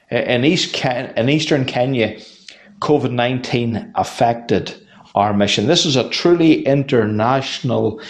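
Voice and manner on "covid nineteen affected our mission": normal talking voice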